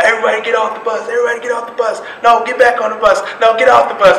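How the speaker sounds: high-pitched rapid-fire orders; imitated drill instructor, urgent and high; drill instructor yelling. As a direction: yell contradictory commands at new recruits. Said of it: "drill instructor just starts yelling".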